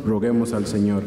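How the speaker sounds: ordinary delivery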